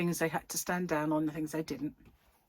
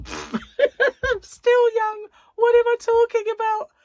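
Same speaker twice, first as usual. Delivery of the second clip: high, loud, laughing protest; 12 dB louder, slower than usual. Real, not acted. She laughs off calling herself old to viewers. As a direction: laugh it off, much higher and louder. Laughing correction of her age.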